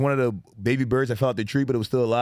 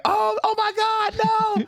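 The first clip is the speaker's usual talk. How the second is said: much higher, slower shocked gasp; mock horrified women; slower than usual. Retelling how ex-wife and niece reacted, high mock horror.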